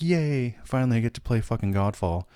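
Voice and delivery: high, half-hearted cheer without extra volume; mock fan cheer. Imagined Xbox fans greeting a late port, mock excited.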